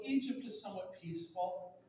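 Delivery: ordinary delivery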